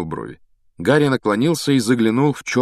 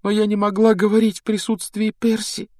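put-on high-pitched, pleading girl's voice; a girl confessing, voice lifted high and urgent; distraught young girl; 13 semitones higher than usual. Girl confessing to her rescuer what she was made to do, high and distraught.

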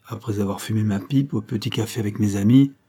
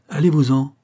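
short, sharp, pushed a bit higher and louder; curt dismissal; 4 semitones higher, 4 dB louder than usual. A narrator's reading. Old clerk shooing the dog away, curt. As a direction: shoo the dog off firmly. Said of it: "un net".

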